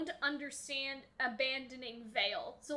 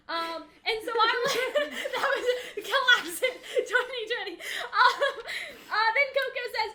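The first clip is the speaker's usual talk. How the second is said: high, loud talk through laughter; real giggles breaking up the words; laughing; 7 semitones higher, 6 dB louder than usual. Real, not acted. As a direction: crack up while trying to go on. Cracks up while reading own notes.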